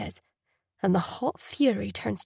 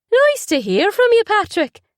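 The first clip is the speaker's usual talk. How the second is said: much higher, louder mimicry of his brother; mocking high voice; 12 semitones higher, 8 dB louder than usual. Brother on the phone mocking Patrick's voice, high and sneering. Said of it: "he says in a high voice".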